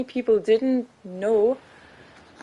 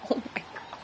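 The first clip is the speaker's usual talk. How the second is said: whispered oh my god; 13 dB quieter, much faster than usual. Real, not acted. Stunned by something in the game.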